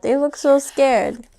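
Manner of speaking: ordinary delivery, nothing marked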